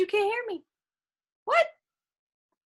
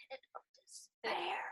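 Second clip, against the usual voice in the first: mock dramatic whisper; 16 dB quieter than usual. Dramatic dark phrase.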